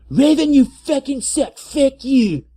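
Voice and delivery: put-on high, loud furious girl voice, up in the air; shrill author's rant. Reader voices the author cursing at Raven, high and loud. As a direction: scream the author's note like an enraged teen. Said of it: "Author's note".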